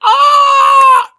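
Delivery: high, loud mock "ah"; imitated crowd scream. Streamer mimicking woman in the crowd, mock scream. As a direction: squeal like an overexcited woman in the crowd. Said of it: "she's just like".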